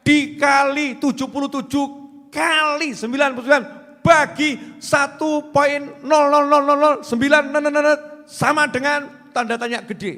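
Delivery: loud, high chanted list of impossible numbers; preaching at full force. Acted. Preacher dazzling the congregation with an impossible sum, loud and chanting.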